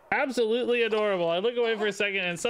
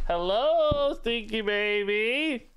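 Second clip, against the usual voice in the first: normal talking voice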